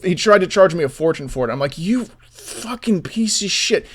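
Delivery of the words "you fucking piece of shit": a slow seething put-down; slow contemptuous curse. Retold or imitated. Retelling cursing out the freelancer, slow and contemptuous. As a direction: drag out the insult, slow and disgusted.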